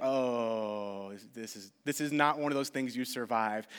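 lightly voiced pastor delivering bad news; grave pastor. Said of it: "And he's like".

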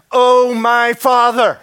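slow, loud anguished plea; crying out. Acted. Preacher voicing Jesus praying in Gethsemane. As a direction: pour it out, face on the ground. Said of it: "he's crying out".

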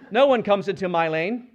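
loud, high indignant outburst; angry driver. Acted. Driver cut off in his lane, loud territorial shout. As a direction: bark it like a driver who's been cut off.

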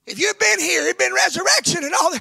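high, loud complaint, rushing at the end; indignant Martha. Acted. Martha reproaching Jesus, high and loud. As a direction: throw the reproach at him.

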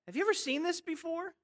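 ordinary delivery

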